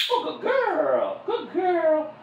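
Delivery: high, bright cheerful praise; upbeat happy praise. Real, not acted. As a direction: cheer her on like a happy fan. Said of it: "nice, happy, upbeat".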